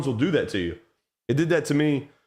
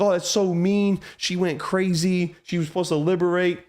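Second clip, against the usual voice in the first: mock whining; 6 semitones higher than usual. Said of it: "Everybody was like".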